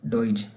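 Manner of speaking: slow डॉइच, gliding from "o" into "i"; O sound, then E. Shown as a demonstration. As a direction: stretch out डॉइच as DOYCH. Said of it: "पहले ओ की आवाज़ फिर ई की आवाज़". (डॉइच धीरे से, पहले ओ फिर ई की आवाज़)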